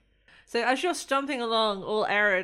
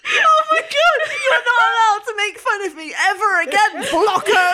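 howling with laughter; 12 semitones higher, 11 dB louder than usual. Real, not acted. Laughing helplessly at new name.

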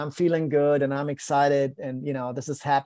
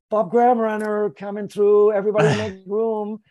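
higher, louder crowd announcement; mock announcer call; 6 semitones higher, 4 dB louder than usual. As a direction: herald the runner's arrival. Crowd calling runner through.

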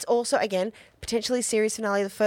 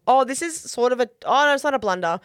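ordinary talk

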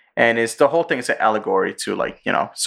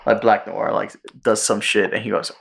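usual speaking voice

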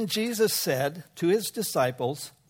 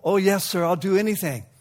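eager yes; 5 semitones higher, 6 dB louder than usual. Acted. Alexander eagerly agrees again.

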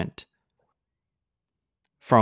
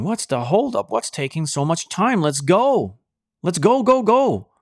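urgent messengers; 6 semitones higher than usual. Acted. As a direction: rush them out the door. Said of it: "The messengers urged Lot".

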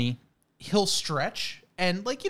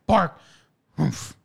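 dog bark; slower than usual. Retold or imitated. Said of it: "he'll just go".

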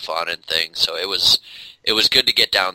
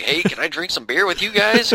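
much higher mock voice asking to join the party; goofy out-of-place older guy; 10 semitones higher than usual. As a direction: play the awkward old guy begging for beer. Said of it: "kind of thing".

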